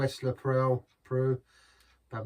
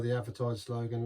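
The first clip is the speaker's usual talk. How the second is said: normal talking voice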